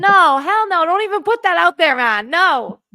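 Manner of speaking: loud, hammed-up Scottish protest; shouted mock Scottish accent. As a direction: yell it in a rough, bad Scottish accent. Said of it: "Weirdly Scottish".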